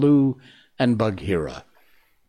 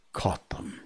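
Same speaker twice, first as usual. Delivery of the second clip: whisper; 9 dB quieter than usual. A narrator's reading. Narrator's menacing hush.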